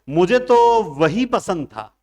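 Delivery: loud, slow stress on तो; emphatic. Shown as a demonstration. Teacher shows insistence on a choice, loud and slow.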